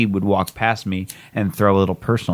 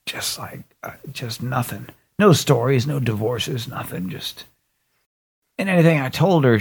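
usual speaking voice

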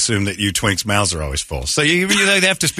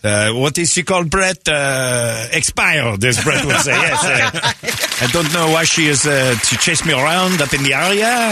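mock French accent; hammed-up French athlete; Victor Wembanyama; 2 semitones higher than usual. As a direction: apologize formally in a thick French accent. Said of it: "My name is Victor Wembanyama".

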